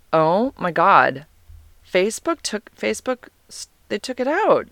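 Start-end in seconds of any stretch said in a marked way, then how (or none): 1.9-4.7 confused high voice. Real, not acted. Searching phone, confused.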